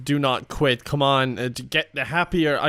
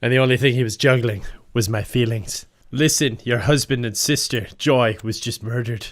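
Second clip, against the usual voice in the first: low, hushed trailer narrator; dramatic movie narration; 4 semitones lower than usual. Acted. Narrating her Sims movie for viewers, dramatic voiceover. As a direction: set the scene like a soap opera voiceover.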